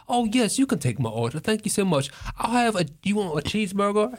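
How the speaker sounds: high-pitched, put-on British voice; British accent. Friend ordering at the drive-thru in a mock British accent. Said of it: "this cat does the British impression".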